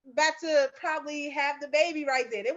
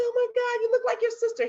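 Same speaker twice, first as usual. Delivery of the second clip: much higher, cooing wonder; tender amazement; 12 semitones higher, faster than usual. Retold or imitated. Mother holding her newborn son, high and amazed. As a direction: re-enact marvelling at a newborn.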